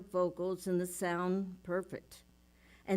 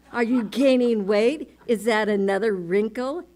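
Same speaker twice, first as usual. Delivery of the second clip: loud, higher nagging jab; inner critic; 3 semitones higher, 11 dB louder than usual. Retold or imitated. The inner critic mocking her weight, loud nagging. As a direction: needle her about her looks. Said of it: "And she says".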